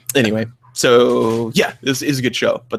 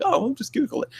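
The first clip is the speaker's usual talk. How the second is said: much higher, airy smug dismissal; smug brush-off; 4 dB quieter than usual. Retold or imitated. Mocking a debate opponent, high smug brush-off. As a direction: brush them off lightly. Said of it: "they're like".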